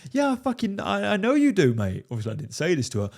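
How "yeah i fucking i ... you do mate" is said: much higher, strained, sarcastic; exasperated retort. Retold or imitated. Mocking his girlfriend's text, high exasperated sarcasm. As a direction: snap back sarcastically, fed up.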